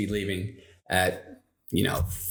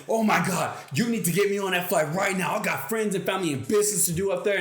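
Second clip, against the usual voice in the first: fast, higher mock panic; mock bananas; 7 semitones higher, faster than usual. Imagined passenger frantically demanding a seat, mock panic. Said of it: "Would you prefer that I go bananas".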